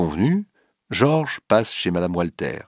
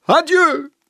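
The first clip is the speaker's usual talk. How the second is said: even higher, loud despairing farewell; shrill-peaked final Adieu, loud and falling; crying out; 5 dB louder than usual. A narrator's reading. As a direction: push the second Adieu much higher. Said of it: "Puis il se redresse et crie".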